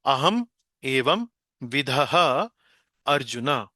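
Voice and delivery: अहम एवं विधः pulled apart very slowly with a rising drawn tune; अहम एवं विधः arjuna said slowly, word by word; split apart slowly. Shown as a demonstration. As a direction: stretch each separated word out for the class. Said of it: "देखिए".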